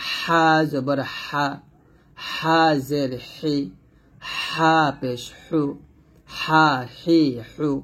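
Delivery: slow recitation, "হা" squeezed from mid-throat; drawn-out, constricted throaty "হা"; pressed thin "হা". Shown as a demonstration. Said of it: "চাপ দিয়ে চিকন করে উচ্চারণ".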